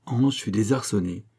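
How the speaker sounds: plain reading voice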